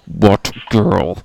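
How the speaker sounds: loud, punchy greeting; shouted catchphrase. Acted. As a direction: belt out the catchphrase. Playful shouted show catchphrase.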